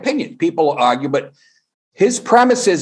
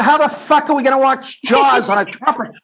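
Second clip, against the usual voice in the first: yelled in disbelief, pitched up; incredulous shout; 10 semitones higher than usual. Retold or imitated. Comedian yelling at his broke roommate over the trumpet. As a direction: shout it like you can't believe it.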